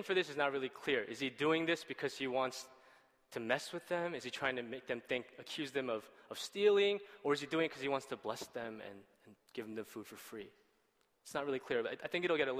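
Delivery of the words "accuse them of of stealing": ordinary talk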